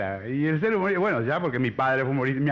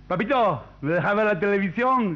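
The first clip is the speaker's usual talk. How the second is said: put-on higher, louder child's plea; little boy; 6 semitones higher, 5 dB louder than usual. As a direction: play a kid begging dad. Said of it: "Un niño le dice al padre". (voz de niño pidiendo, aguda y fuerte)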